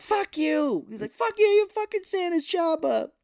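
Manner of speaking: high, shrill-pitched mock yell; shrieking curse. [name] cursing Dudley Moore, high mock yell. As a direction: squeal the curse in mock fury.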